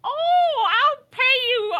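high, loud, squeaky goblin yelp. Retold or imitated. Goblin haggling with the party, high and shouting. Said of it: "the goblin was like".

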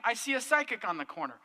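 normal talking voice

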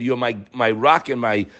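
ordinary talk

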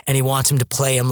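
ordinary talk, nothing marked

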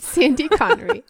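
giggling through the name; can't keep a straight face; laughing. Real, not acted. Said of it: "Can't keep a straight face with this one".

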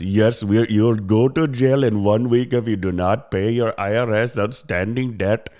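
slow, insistent mock Indian accent; put-on Indian accent, laying down a threat. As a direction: imitate a pushy Indian-accented scam caller. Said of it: "with, like, an Indian accent".

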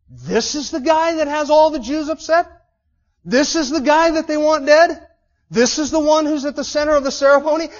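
incredulous crowd. Retold or imitated. Onlookers in the hall doubting Paul deserves the fuss, loud incredulous scorn. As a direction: ask it in loud scornful disbelief. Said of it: "Everybody would have been saying that".

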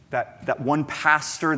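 normal talking voice

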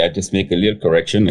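usual speaking voice

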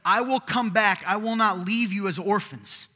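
loud, rising preacher's cry; fervent sermon climax; preaching at full force. Acted. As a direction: proclaim it to the whole room. Quoting Jesus again, louder.

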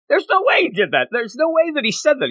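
high-pitched incredulous retort; disbelief. Retold or imitated. Retelling her refusal to believe his confession, high-pitched disbelief. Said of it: "she doesn't believe it".

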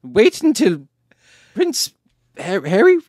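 put-on very high, loud, stubborn old monarch; badly faked British queen, digging in her heels; Queen Elizabeth. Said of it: "impression of the dead Queen Elizabeth".

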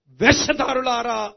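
loud, drawn-out denunciation; rebuking address. Acted. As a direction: call them out slowly and forcefully. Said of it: "యేసేమని పిలిచాడో తెలుసునా".

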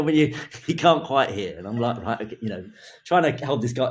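ordinary talk

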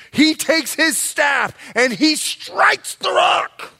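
angry shout. Acted. Moses striking rock in anger.

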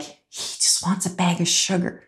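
quiet aside. Retold or imitated. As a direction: say it under your breath, knowingly. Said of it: "And I remember saying to her, like".

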